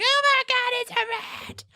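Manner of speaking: high squealing mock panic; very high, hammed-up fright; screaming panic. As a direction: play a roomful of girls shrieking. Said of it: "They all start screaming".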